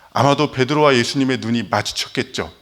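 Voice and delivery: ordinary talk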